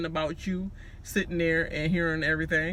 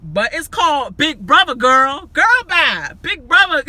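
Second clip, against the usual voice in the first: high, loud hyped-up rant; fired up, preaching the game; excited; 8 semitones higher, 9 dB louder than usual. Real, not acted.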